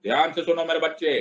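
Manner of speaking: usual speaking voice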